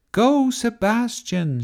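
high, drawn-out cheer; cheering shout. A narrator's reading. Fritz cheering Sebastian into the fight, cheering shout. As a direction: cheer on your favorite knight. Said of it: "shouted Fritz".

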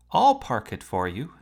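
a sudden eager near-shout; almost shouting. A narrator's reading. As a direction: blurt it out, almost yelling. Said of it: "he almost shouted".

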